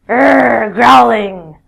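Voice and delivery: loud growl on they were growling; growling dogs. Shown as a demonstration. Teacher growling to act out the dogs. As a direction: growl it like an angry dog. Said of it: "group of dogs".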